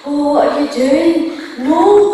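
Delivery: loud, high-pitched Scottish scolding; Scottish aunt. Retold or imitated. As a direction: imitate a Scottish aunt crying out in protest. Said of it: "a Scottish aunt".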